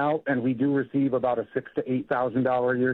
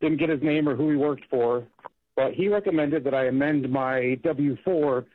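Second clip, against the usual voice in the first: usual speaking voice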